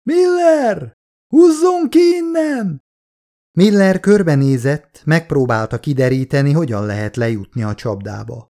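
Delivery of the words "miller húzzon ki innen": high, slow shout from far below; distant weak cry. A narrator's reading. Soldier trapped below, crying up to the scientist, high and slow. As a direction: cry for help from the bottom of a pit. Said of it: "A válasz távolról érkezett, és erőtlen volt".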